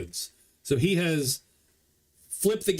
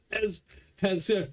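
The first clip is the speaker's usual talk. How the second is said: usual speaking voice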